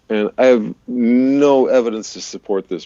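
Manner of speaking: normal talking voice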